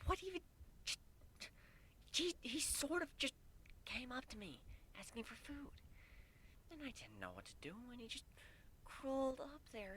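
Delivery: high, soft, flustered rambling; protest, then gush. A narrator's reading. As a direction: mare explaining, ending in a whisper. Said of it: "Kuno protested immediately".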